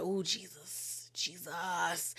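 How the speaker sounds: slow, very soft, under the breath; drawn-out, barely voiced reaction; hushed dismay. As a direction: imitate a stunned guest murmuring to herself. Katie reacts in shock to name.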